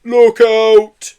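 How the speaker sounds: high, very loud, urgent shout; warning. A narrator's reading. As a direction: warn of sudden danger. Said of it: "she warned".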